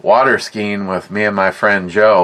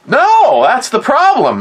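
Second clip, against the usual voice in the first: much higher, louder, panicked answer; startled reply; 13 semitones higher, 3 dB louder than usual. Retold or imitated. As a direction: blurt it out like a caught kid. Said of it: "I'm like".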